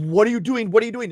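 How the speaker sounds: high-pitched, alarmed questioning, imitating wife; freaking-out wife. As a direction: act out a wife starting to panic. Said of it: "freaking out".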